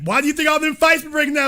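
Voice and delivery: normal talking voice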